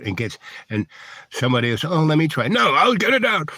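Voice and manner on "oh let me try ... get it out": put-on higher, eager kid grabbing a turn; childish squabble. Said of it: "they're like five-year-olds".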